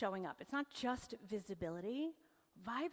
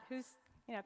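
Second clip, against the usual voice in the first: usual speaking voice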